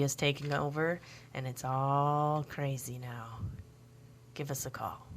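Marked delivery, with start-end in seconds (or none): 1.3-5.1 whisper. Acted. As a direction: whisper it like a secret. Whispered aside on air.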